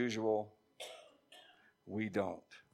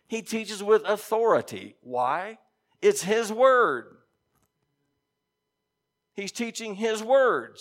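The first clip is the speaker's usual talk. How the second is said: higher and louder, leaning on authority and word; preaching emphasis; 8 semitones higher, 5 dB louder, slower than usual. Real, not acted. Preacher stresses Christ's authority to the congregation, emphatic slow build. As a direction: drive the point home slowly from the pulpit.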